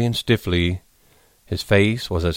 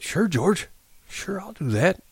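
put-on high, softer old man's assent; meek old man; 11 semitones higher, 5 dB quieter than usual. A narrator's reading. Old hand agrees to George's plan, high and meek. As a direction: agree meekly in a high, swooping voice.

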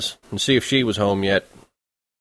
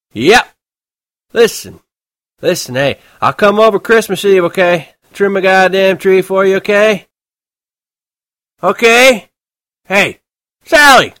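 put-on drunken shouting; 10 semitones higher, 8 dB louder than usual. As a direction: slur it loud into the phone, very drunk. Drunk Holden on phone.

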